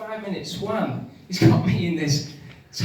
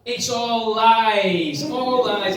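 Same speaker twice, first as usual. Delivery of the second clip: mock outrage; 4 semitones higher, 7 dB louder, much slower than usual. Comic indignant outburst.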